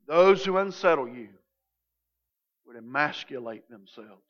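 measured solemn reading of the text; scripture reading. Acted. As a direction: read the passage with reverence. Reading the scripture passage aloud.

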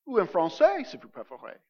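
switching into French, softly. Shown as a demonstration. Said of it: "or in French, if you prefer".

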